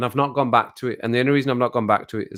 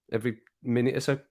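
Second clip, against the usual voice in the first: ordinary talk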